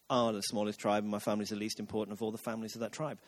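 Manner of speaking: low, quiet, rushed mock-humble mumble; dismissive low imitation of false modesty; mocking mimicry. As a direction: parrot the humble excuse under your breath, fast. Mimics Saul's humble reply back mockingly.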